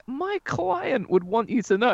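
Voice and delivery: high, smug manager voice; Paul Heyman impression. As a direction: gloat like a wrestling manager. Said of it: "when Paul stands next to him and he's like".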